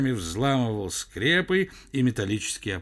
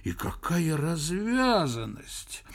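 slow, higher indignant exclamation; self-upsetting indignation; 5 semitones higher, slower than usual. A narrator's reading. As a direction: work yourself up over the cheek of it. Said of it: "расстраивал сам себя Персиков".

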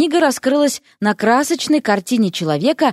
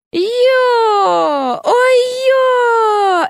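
long, high-pitched wailing "yo-o"; startled yelp; 13 semitones higher, 3 dB louder, much slower than usual. A narrator's reading. Frog Vasya toppling on her side, yelping. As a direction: let out a drawn-out falling-over cry.

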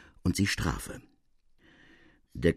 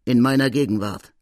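plain reading voice

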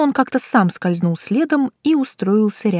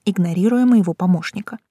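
ordinary delivery, nothing marked

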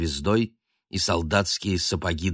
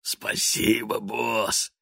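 breathy grateful whisper; wheezy whisper; 4 semitones higher, much slower than usual. Acted. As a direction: whisper thanks like a hoarse minion. Trash man thanks.

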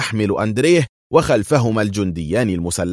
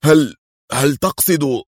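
higher, slow, stammered question; trembling emotion; 6 semitones higher than usual. A narrator's reading. Qadri dares hope his friend lives, voice faltering. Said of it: "وتهدج صوته وهو يقول في انفعال".

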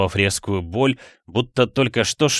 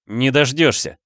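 higher-pitched angry retort; defiant cry; 5 semitones higher than usual. A narrator's reading. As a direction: call out your refusal defiantly. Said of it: "крикнул Флинн". (дерзкий выкрик)

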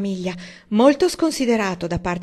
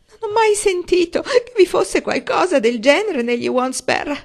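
much higher, louder tearful cry; sobbing; 10 semitones higher, 8 dB louder than usual. A narrator's reading. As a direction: sob out the denial. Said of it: "singhiozzò". (madre che singhiozza offesa)

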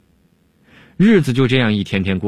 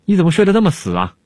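mock surprise; 9 semitones higher, faster than usual. Dongfang teases the sleeping alchemist.

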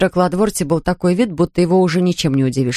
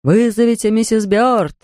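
a distant, higher echo from the corridor; echoing call; 4 semitones higher than usual. Acted. Voice in corridor echoes the summons, distant. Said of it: "эхом повторил голос из коридора".

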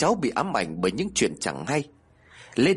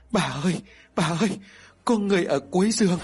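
put-on high scared little child calling grandma; frightened child; 6 semitones higher, faster than usual. A narrator's reading. Crying grandchild warning grandma of someone by the bed, frightened. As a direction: call out in fear, pitch climbing. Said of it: "cả hai liền lại khóc ré cả lên".

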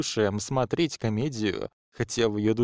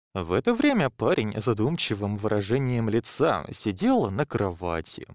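ordinary delivery, nothing marked

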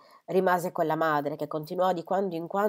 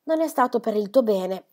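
exclaimed; 5 semitones higher, 6 dB louder than usual. Acted. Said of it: "esclamò missus Gibson".

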